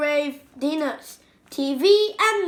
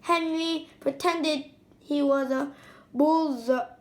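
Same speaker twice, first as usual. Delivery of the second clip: ordinary delivery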